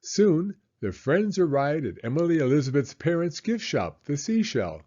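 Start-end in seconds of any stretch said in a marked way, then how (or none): none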